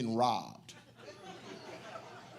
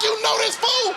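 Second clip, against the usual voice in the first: much higher, louder laughing retort; incredulous shout; 11 dB louder than usual. Retold or imitated. Comedian yelling back at the policeman about Blade.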